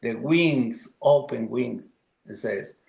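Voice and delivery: ordinary talk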